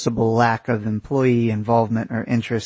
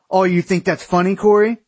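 high, louder indignant question; offended challenge; 8 semitones higher, 5 dB louder than usual. A narrator's reading. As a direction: challenge a friend who laughed. Aaron snaps at laughing Corey.

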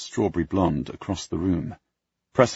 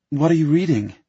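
curious woman; 10 semitones higher, 6 dB louder than usual. Acted. Olivia asks curiously.

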